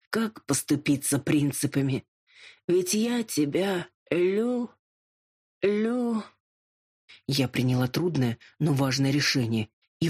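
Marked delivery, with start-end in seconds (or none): 0.1-6.2 higher, stumbling over the word; a drunk lord who can't finish "люблю"; stammering drunk confession. A narrator's reading. (пьяный лорд запинается на «лю-»)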